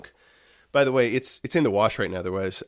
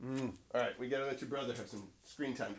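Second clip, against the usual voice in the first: ordinary talk, nothing marked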